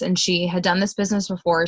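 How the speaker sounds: ordinary talk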